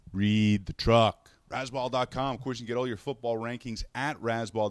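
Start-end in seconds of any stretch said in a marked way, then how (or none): 0.1-2.3 much louder, slow read the truck; slow, loud mock exasperation; exasperated. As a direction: spell it out to someone who missed the obvious. Exasperated at man reading truck.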